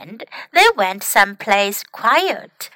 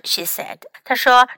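plain reading voice

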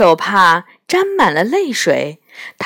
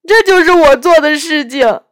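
a lightly voiced tearful faun, louder; weeping faun; 8 semitones higher, 8 dB louder than usual. Faun confessing his wickedness to Lucy, tearful faun voice. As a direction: play the ashamed faun in tears.

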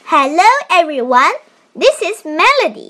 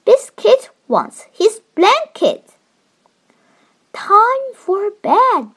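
put-on reading to a toddler; 5 semitones higher than usual. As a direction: read it gently to a little one. Child reads picture book aloud.

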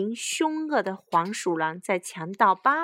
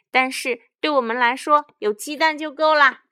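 high, loud villain retort; crowing bandit boast; loud gloating; 6 semitones higher, 8 dB louder than usual. A narrator's reading. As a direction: brush off advice with greedy relish. Bandit boss mocks the hens' advice.

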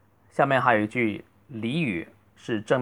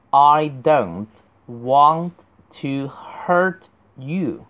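slow, with a gap between chunks; word-by-word reading; 3 semitones higher, much slower than usual. Shown as a demonstration. Teacher models for students with each word apart, slow and deliberate. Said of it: "你要读成hurt you".

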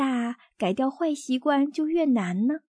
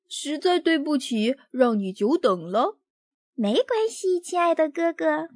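sugary exaggerated politeness; overly polite; 4 semitones higher than usual. A narrator's reading. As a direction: lay the politeness on thick. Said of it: "处处做到超级礼貌".

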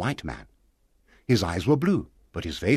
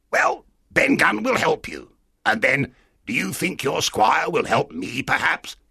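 high, eager castaway voice; strange castaway; 7 semitones higher than usual. Acted. Castaway asking the boy for the squire's help, strange high voice. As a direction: plead a little in an odd, raised voice.

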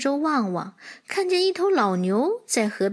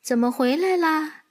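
higher-pitched motherly question; mother horse asking; 6 semitones higher than usual. Acted. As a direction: ask your foal, puzzled. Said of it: "妈妈问他". (马妈妈提高音调询问)

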